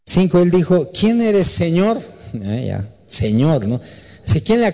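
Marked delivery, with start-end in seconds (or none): 0.9-2.0 high, startled asking; startled question. Acted. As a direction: ask upward in shock. Saulo asks the voice.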